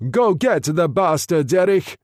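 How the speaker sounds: fierce rallying shout. A narrator's reading. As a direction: egg the E-boat on, yelling. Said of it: "Orsini shouted".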